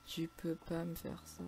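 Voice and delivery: soft, slow, drawn-out begging; resigned, fading lament; deflated plea. Real, not acted. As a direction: let the plea sink away quietly. Same plea repeated, deflated.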